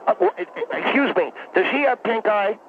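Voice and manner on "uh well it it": high cartoonish stutter; cartoon stammer. Real, not acted. Doctor fumbles for an answer on air, cartoonish stammering. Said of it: "you sounded like something out of a Hanna-Barbera".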